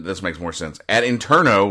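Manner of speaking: normal talking voice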